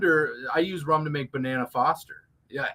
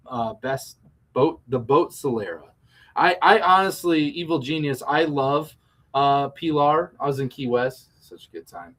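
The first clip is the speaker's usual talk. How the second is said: usual speaking voice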